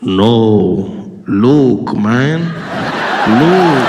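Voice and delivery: deep, loud villain turned casual; booming low voice pleading man to man; Darth. Acted. Villain at the moment of realization, deep booming Darth. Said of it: "epic scene between Luke and Darth".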